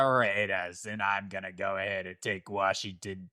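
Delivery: put-on slow, low Boston accent drawl. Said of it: "like, a Boston accent".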